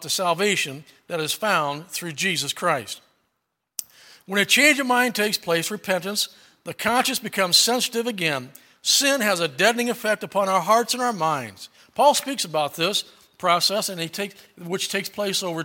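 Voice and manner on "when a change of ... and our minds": loud, emphatic sermon delivery; preaching. Acted. Preacher urges the congregation, loud and emphatic. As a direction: drive each phrase home to the pews.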